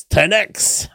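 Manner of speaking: high, loud catchphrase shout; excited shout. Acted. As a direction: belt it out like a jingle. Yes, the ten X is back.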